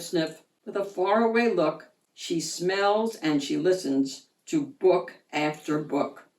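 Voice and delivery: usual speaking voice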